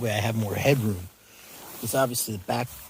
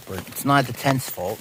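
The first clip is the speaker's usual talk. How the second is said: usual speaking voice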